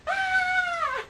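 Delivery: high, loud startled scream; re-enacted shriek of fright. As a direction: scream as if something just dropped in front of you. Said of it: "I'm like".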